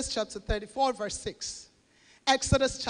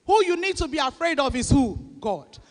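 normal talking voice

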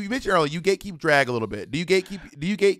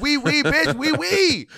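high, sassy fake French; mock French; 10 semitones higher, slower than usual. Friend doing fake French for the camera, high and sassy. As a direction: toss it off like a snooty Parisian. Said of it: "fake French".

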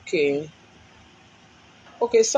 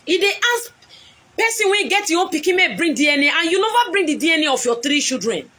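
much higher and raised, fast at first; heated accusation; 6 semitones higher than usual. Real, not acted. Woman on live stream demanding DNA tests from her rival. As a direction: hammer the demand.

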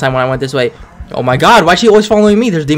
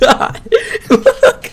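laughing. Real, not acted. Laughing fit.

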